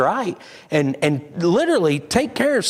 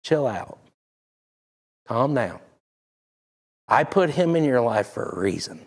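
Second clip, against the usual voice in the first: calm Lord; 3 semitones lower than usual. Retold or imitated. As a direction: soothe an angry man down. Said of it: "the Lord's like".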